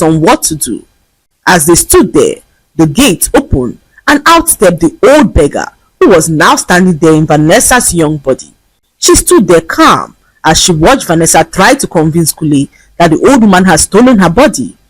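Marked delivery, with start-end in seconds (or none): none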